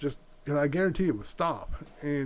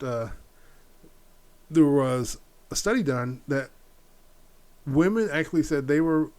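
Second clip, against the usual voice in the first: ordinary delivery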